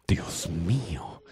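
slow, breathless whisper of alarm. Acted. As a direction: whisper it in shock. Hero hears a baby cry. (susurro lento y asustado)

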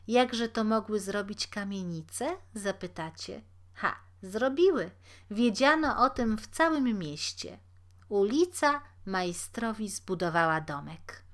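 usual speaking voice

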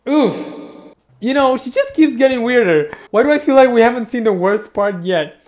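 agitated. Real, not acted. Disgusted loud commentary.

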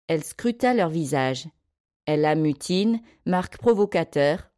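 plain reading voice

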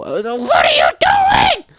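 high, loud shout of disbelief; exasperated shout. Real, not acted. Player yelling at a sim who won't obey, loud shout. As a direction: yell it at the screen, fed up. Exasperated at sims grabbing child.

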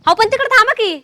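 irritated. Acted. Wife shooing husband while she sweeps, irritated. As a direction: scold him to stay out of the way.

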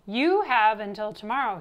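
loud, upset ultimatum; upset cry. A narrator's reading. Upset, confused coach warns Louis, crying out. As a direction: lay down the deadline loudly. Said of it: "he cried".

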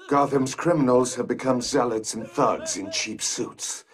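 normal talking voice

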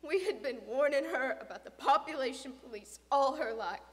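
loud, emphatic grieving questions; anguished bewilderment. Acted. Father questioning dead daughter's crusade, anguished. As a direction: demand an explanation out of grief.

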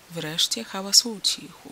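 ordinary delivery, nothing marked

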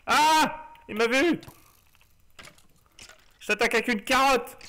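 startled high yelp into mock battle cry; panicking gamer. Real, not acted. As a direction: shout it, spotted and flustered. Spotted by enemy, attacks.

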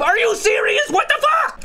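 high, shouted disbelief; shocked outrage. Real, not acted. Shouting in disbelief at the ranking, shocked. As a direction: cry out in shock at the score.

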